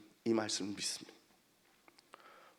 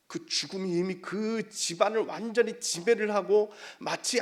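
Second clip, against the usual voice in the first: raised, urgent sermon delivery; impassioned preaching; 9 semitones higher, 9 dB louder than usual. Real, not acted. Preacher describes the grieving house, impassioned preaching. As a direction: build the scene with rising force.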